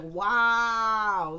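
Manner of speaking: loud, drawn-out wow of admiration; amazed wow. Retold or imitated. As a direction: let an impressed wow ring out. Acted amazed reaction to the actor.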